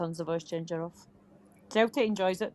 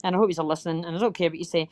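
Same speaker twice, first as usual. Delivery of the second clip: ordinary talk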